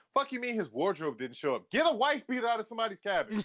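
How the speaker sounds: rising, incredulous comic indignation; mock outrage. Retelling own indignant reaction.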